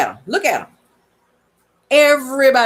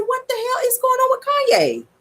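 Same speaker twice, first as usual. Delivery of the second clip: incredulous. Retold or imitated. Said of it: "I said".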